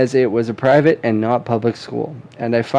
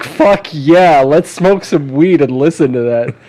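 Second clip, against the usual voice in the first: mock excited dad; 3 semitones higher, 4 dB louder than usual. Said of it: "I'd be like".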